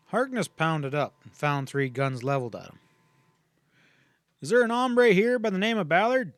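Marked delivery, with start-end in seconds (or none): 4.4-6.3 loud, higher challenge called out; demanding. A narrator's reading. Ranger on horseback calling to three gunmen, loud demand. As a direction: ride up and demand an answer. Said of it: "he demanded".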